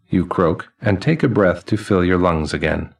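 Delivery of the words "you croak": ordinary delivery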